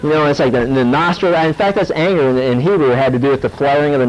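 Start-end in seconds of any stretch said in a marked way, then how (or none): none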